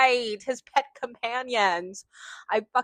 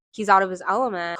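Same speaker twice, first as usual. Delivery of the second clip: usual speaking voice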